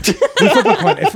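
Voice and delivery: high, slow, laughing through it. Real, not acted. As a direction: stretch it out while cracking up. Repeating his offer through laughter.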